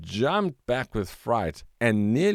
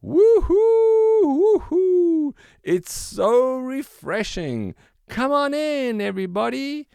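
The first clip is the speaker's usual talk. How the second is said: gleeful shouting; 9 dB louder than usual. A narrator's reading. Waldo pops up out of the water calling friends in, whooping. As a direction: whoop with pure joy. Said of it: "laughing and shouting".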